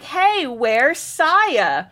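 high, drawn-out voice of a concerned schoolgirl; classmate calling out. Retold or imitated. Voices a classmate asking after Saya.

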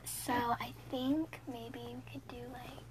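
soft, slow unvoiced whisper. Real, not acted. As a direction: whisper it like a secret. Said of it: "She's, like, trying to whisper".

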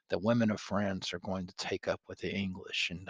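ordinary delivery, nothing marked

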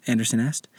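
ordinary delivery

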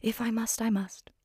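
soft breathy whisper, almost voiceless; nanny agrees to pour the tea, in a whisper; whispered reply. Retold or imitated. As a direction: whisper it with a resigned little sigh. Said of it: "And she says".

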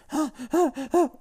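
high mock gasp; a hammed-up shocked gasp; overdone gasp of fake shock. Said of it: "they're like".

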